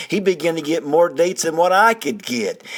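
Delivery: ordinary delivery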